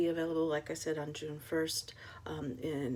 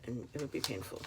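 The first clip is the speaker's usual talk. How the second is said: ordinary delivery, nothing marked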